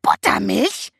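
Buttermilch shrieked high and loud, rising; shrieking raven. A narrator's reading. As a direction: screech Buttermilch like an outraged raven. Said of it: "kreischte Abraxas". (kreischender Rabe, empört)

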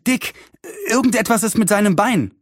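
high, urgent report, mildly winded; lightly acted breathless alarm; out of breath. A narrator's reading. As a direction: blurt out the bad news. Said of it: "rief George außer Atem".

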